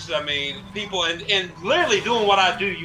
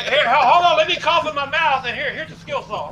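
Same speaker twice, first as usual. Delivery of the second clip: lightly acted oblivious workmate, higher at first; hammed-up helpful coworker; mock eager coworker; 7 semitones higher than usual. Acts out unhygienic coworker handing tool.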